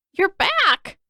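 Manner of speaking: put-on high, bright call of relief from an old woman; excited old woman. A narrator's reading. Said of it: "waved both arms".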